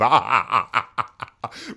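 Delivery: big real burst of laughter; cracking up at the line; loud laugh. Real, not acted. Preacher laughing out loud at the comeback, loud laughing. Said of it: "Bro basically said".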